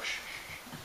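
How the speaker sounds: soft unvoiced shushing. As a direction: hush a noisy child. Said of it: "And his mum's like".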